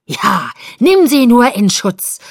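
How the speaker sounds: loud, slow bellow; furious bellowed taunt; enraged roar. A narrator's reading. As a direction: roar it, beside yourself with rage. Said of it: "brüllte Paul außer sich".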